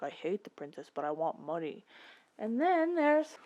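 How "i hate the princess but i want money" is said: low, soft grumble; grumbling Han. Acted. Han telling Luke he only wants money, low grumble. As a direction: mutter it like a greedy rogue. Han voiced, much lower.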